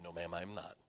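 very soft, low refusal; quietly unyielding. Retold or imitated. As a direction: refuse politely, barely raising the voice. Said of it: "I can tell by your voice you're not changing".

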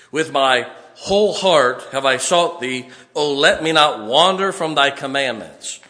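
slow, loud, weighty reading of verse; forceful scripture reading. Real, not acted. Preacher booming Psalm verse to congregation, slow and forceful. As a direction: read the verse like a proclamation.

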